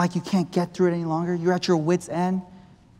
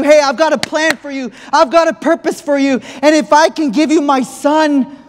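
raised, loud preaching as God speaking; preaching God's plea; 8 semitones higher, 7 dB louder than usual. Acted. Preacher voicing God to the church, loud and fervent. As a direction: preach it out like God pleading with his people.

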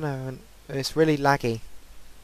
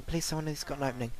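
rushed, under the breath, very fast; fast, softer mutter; 7 dB quieter, much faster than usual. Real, not acted. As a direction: mumble it quickly to yourself.

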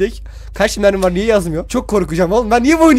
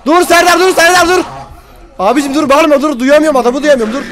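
high, loud urgent stop; yelling at a noisy friend; 9 semitones higher, 6 dB louder than usual. Real, not acted. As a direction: shout at your friend to stop.

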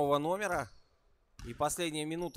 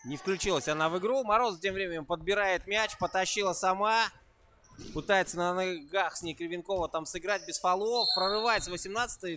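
louder, higher commentary riding the attack; live play-by-play; 5 semitones higher, 5 dB louder than usual. Real, not acted. Commentator follows a drive across the court, excited play-by-play. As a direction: call the fast break with rising energy.

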